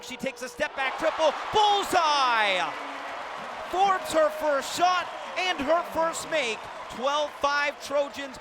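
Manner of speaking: build, then explode on the bullseye; loud, high shout, then slow, emphatic follow-up; thrilled three-point call. Real, not acted. Announcer celebrates Forbes' first triple, thrilled.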